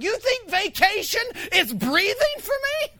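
incredulous retort to a friend. Retold or imitated. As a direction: scoff at the idea. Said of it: "I'm like".

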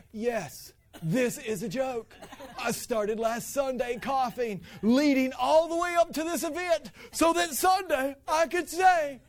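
slow, rising sarcastic rant; sarcastic confession swelling to a shout; exasperated sarcasm. Acted. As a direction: build from mock agreement to loud, high declaration. Sarcastic answer to wife.

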